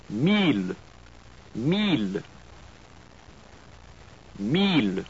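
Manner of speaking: "Mille" as meel, clear final l; French pronunciation. Shown as a demonstration. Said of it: "followed by the French word twice".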